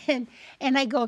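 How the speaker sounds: usual speaking voice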